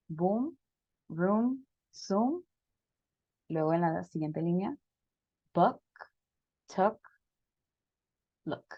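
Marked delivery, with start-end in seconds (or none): 0.1-2.4 "Boom", "room", "zoom" with a long u, pitch rising; rounded long u in "Boom" "room" "zoom"; long oo sound. Shown as a demonstration. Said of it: "Cambia un poquito la posición de mi boca". 5.5-8.6 "buck", "tuck", "look" with a short, clipped u; short oo sound. Shown as a demonstration. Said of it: "Entonces cambia un poquito".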